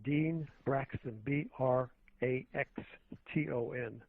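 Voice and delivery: slow, deliberate letter-by-letter spelling. Shown as a demonstration. Guest telling host what to type in, slow spelling. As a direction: give the name slowly, then each letter separately. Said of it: "just type in".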